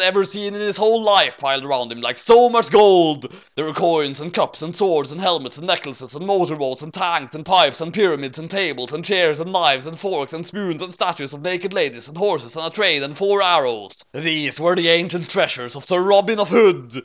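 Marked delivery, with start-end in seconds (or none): none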